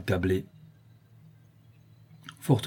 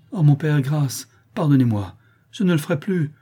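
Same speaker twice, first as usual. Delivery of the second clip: high, raised-voice begging; desperate pleading; 6 semitones higher, 5 dB louder than usual. A narrator's reading. Child begging his father to forgive him, desperate. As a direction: implore for mercy, pitch climbing.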